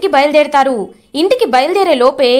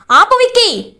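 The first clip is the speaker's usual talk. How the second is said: sharp shout; 7 semitones higher than usual. A narrator's reading. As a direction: cut her brother off with a yell. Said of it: "అని గట్టిగా అరుస్తుంది అరవింద".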